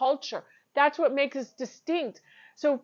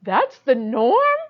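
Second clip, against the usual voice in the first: surprised question; 7 dB louder, slower than usual. Retold or imitated. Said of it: "I'm like".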